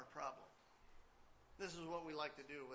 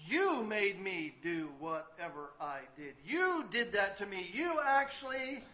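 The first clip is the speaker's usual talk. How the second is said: blaming voice; 10 semitones higher, 20 dB louder, slower than usual. Retold or imitated. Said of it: "This is what we do".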